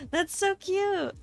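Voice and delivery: cute anime streamer. Real, not acted. Gushing over name.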